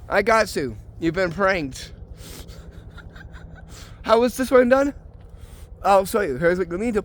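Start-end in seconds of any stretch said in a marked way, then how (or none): 2.0-4.0 quiet unvoiced chuckle after the prank; soft breathy laugh. Real, not acted. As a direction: laugh under your breath, pleased.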